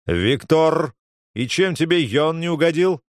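stern call, rolled R on the name; menacing, stress on the second syllable; growled warning. A narrator's reading. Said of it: "грозно раскатывая «Р»".

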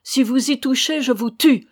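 high, loud furious threat; acted enraged cry; beside herself, crying out. A narrator's reading. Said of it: "cria Ramona hors d'elle".

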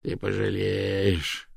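slow, drawn-out groan of warning; priest's groaning warning; moaned threat. A narrator's reading. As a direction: moan it out very slowly. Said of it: "простонал жрец".

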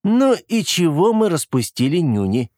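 high, teasing lilt; cheerful teasing. A narrator's reading. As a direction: tease him playfully. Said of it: "весело спросил он".

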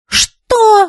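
very high, indignant question; flaring up. A narrator's reading. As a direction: flare up at the insult. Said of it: "взвилась Нефёдова". (взвившись, возмущённо)

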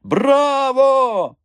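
high, loud drawn-out cheer; cheering shout. A narrator's reading. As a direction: shout bravo like an elated crowd. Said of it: "чулося звідусіль". (захоплений вигук)

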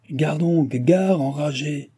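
a rough coachman yelling a warning, the last words drawn out slowly; coachman's shout. A narrator's reading. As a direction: bark it out like a hoarse driver from his seat. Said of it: "lui cria ... une voix rouée".

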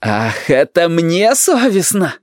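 high, drawn-out cry of outrage; acted outraged grandson; indignant yell. A narrator's reading. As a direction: wail it in slow, rising indignation. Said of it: "завопил Юрик".

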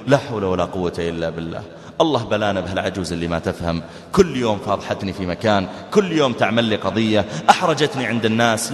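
low, weary imagined complaint; mock grumbling. Son complaining about his embarrassing mother, mock grumbling.

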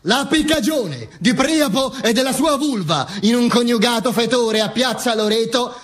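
high, oratorical literary invective; declaimed quotation. Acted. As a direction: proclaim the writer's words in a high voice. Declaims a quote from Gadda. (citazione declamata, acuta)

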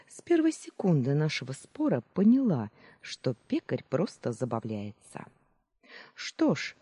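ordinary delivery, nothing marked

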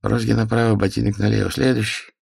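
dull clerk giving routine orders; flat officious bureaucrat. A narrator's reading. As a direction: dismiss them flatly. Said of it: "бесцветным голосом".

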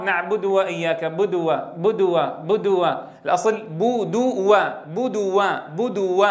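"بدو" with lips unrounded, u slack; slow, lazy "بدو" with a weak u; damma without lip rounding. Shown as a demonstration. المعلم يقلد من لا يضم شفتيه: "بدو". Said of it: "البعض".

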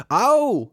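sudden loud, high "ow"; startled yelp. A narrator's reading. Friend touches the strange device, yelps in surprise. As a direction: yelp as if stung.

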